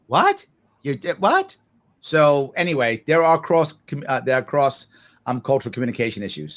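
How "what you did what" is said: mock boomer shock. To a zoomer who said he died, a shocked boomer asks what. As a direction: pitch jumps way up on the first What, then a puzzled rise. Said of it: "When a boomer goes".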